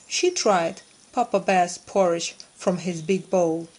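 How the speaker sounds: usual speaking voice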